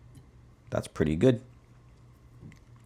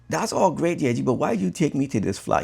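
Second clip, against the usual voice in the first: exasperated complaint; 8 semitones higher than usual. Retold or imitated. And I was like.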